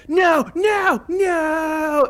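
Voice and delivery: high, loud hammed-up "No, no, no!"; mock movie shout. Mocking the movie's crash scene with a high hammed-up shout. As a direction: yell it like a cheesy movie actor. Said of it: "they just kept like".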